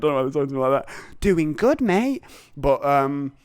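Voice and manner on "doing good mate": high, chirpy mock encouragement; hammed-up high-pitched cheerleading; gushing praise. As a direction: overdo the friendly pep talk. Mimics constant easy praise.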